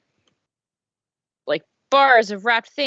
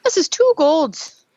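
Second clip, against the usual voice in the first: orc seller price; 7 semitones higher, 6 dB louder, slower than usual. Acted.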